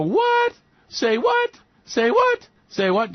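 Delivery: very high, mock-stunned "Say what?"; incredulous squeal. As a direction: squeak it out in hammed-up disbelief. Oil producers react in disbelief.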